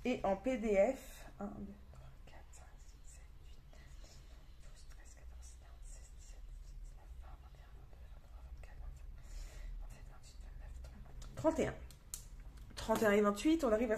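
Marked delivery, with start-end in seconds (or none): 1.4-11.3 barely audible whispered tally; whispered counting. Real, not acted. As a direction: whisper the numbers to yourself. Counting PDFs to herself. (compter en chuchotant)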